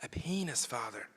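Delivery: put-on boy whispering a rude word to the priest; whispered. A narrator's reading. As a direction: lean in and say it barely aloud. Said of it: "leaned in and whispered".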